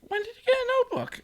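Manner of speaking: very high, fast mock squeal of disbelief; mocking a classmate's shocked look. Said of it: "looked at me like".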